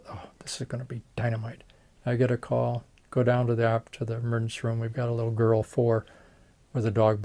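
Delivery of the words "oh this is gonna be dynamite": softer whispered aside; whispered thought. Retold or imitated. Surgeon thinks about his facelift, whispered. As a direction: whisper it to yourself, pleased.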